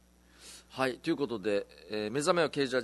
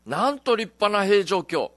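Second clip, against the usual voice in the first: slow, louder, raised quoted line; quoted pun; 6 semitones higher, 9 dB louder, slower than usual. Retold or imitated. Host reading a listener's pun aloud, lightly performed. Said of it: "おっしゃったお言葉".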